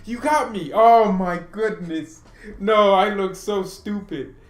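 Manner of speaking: high, loud exclamations of dismay; embarrassed outcry. Real, not acted. Streamer tricked by a viewer's message, embarrassed outcry. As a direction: cry out high, dragging the last words in self-reproach. Realises he misread a chat message.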